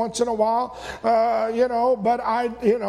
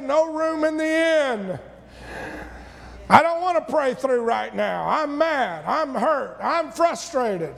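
higher, slow, sulky refusal; tempted complaint; 4 semitones higher than usual. Retold or imitated. Provoked relative at the holidays turning the Lord away, sulky. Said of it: "you get tempted to say".

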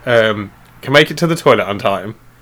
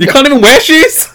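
squeaky put-on voice; 14 semitones higher, 6 dB louder than usual. Mock outrage at partner.

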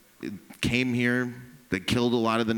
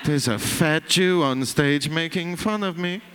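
slightly indignant, higher than usual; mock a put-out local complaining; lightly voiced offended man; 5 semitones higher than usual. Restaurant man grumbling about the show, mock indignant.

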